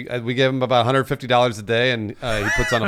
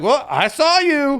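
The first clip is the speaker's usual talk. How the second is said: high, slow accusing wizard voice; mock Ollivander; 13 semitones higher, 3 dB louder, much slower than usual. Ollivander accusing a fan in line, slow and high. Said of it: "And I was like".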